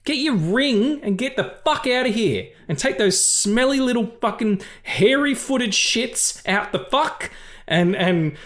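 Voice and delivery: high, exasperated Australian tirade; Elrond as ranting Australian. Acted. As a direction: rant like a fed-up host clearing out the house. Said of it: "that was quite the rant".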